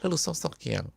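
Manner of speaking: ordinary talk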